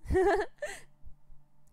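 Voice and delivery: high loud wild cackle; shrill manic cackle. Acted. Captor cackling at pale Gregor, shrill and wild. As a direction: cackle like a madwoman.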